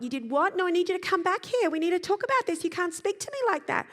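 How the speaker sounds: high, loud, outraged scolding; a parent losing it, loud and high; angry parent. Acted. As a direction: snap at the child, demanding they come back. Parent losing temper at child.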